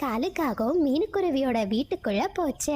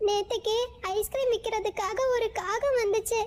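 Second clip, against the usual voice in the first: high, tearful bird voice; small bird telling friends about the theft, high and upset; upset little bird voice; 8 semitones higher than usual. Acted. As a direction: squeak it out in distress.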